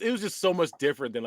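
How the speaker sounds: usual speaking voice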